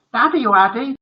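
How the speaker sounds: put-on high, sugary child voice; doting little son. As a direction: call sweetly to daddy.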